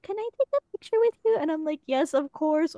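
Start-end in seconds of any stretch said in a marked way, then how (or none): 0.0-1.4 put-on high, quick little-girl voice. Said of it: "I see a little girl". 1.9-2.8 high-pitched, gushing delight; delighted yes. Retold or imitated. Delighted reply to a little girl.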